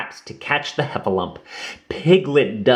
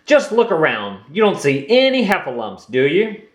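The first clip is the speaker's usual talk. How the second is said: Rabbit's voice; 6 semitones higher than usual. Acted. Rabbit boasting to friends.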